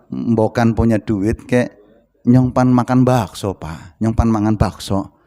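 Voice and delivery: put-on low, slow, subdued child's plea; meek, drawn-out coaxing for a treat; pitiful pleading. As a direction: beg Dad softly and slowly.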